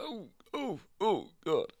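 high-pitched mock groans of pain; acted chest pain. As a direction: imitate someone clutching their chest in pain. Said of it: "he starts clutching his chest".

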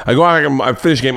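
ordinary delivery

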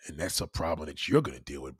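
ordinary delivery

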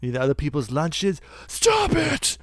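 high shrieking scold. Pretend. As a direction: shriek at the naughty kid. Mock teacher scolding kid Kratos.

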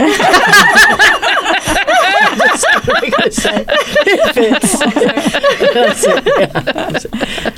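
long, high cackling laugh. Real, not acted. Host cracking up at her own menopause joke.

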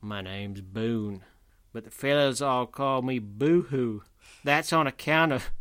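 slow, put-on American cowboy drawl; hammed-up cowboy accent introducing himself. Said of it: "I don't even know what accent I'm supposed to do".

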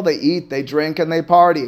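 normal talking voice

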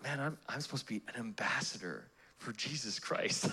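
whispered inner thought; hushed private thought. Acted. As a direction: whisper it to yourself.

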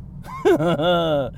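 a shouted, whooping burst of laughter; shouting laugh. Real, not acted.

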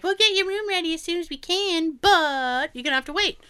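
high-pitched imitation of a hotel receptionist.

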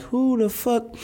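imitated startled confusion; mock confused. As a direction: squeak it out like you have no idea who she is. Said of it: "And I was like".

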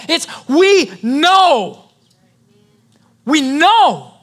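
emphatic, drawn-out pulpit declarations; preaching at full force. Real, not acted. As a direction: declare it with total conviction to the congregation.

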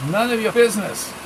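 lightly voiced Spanish-accented thug; a hint of a Spanish accent, curt; small man with a Spanish accent giving orders. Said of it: "His thick Spanish accent".

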